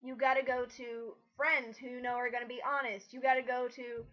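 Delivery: normal talking voice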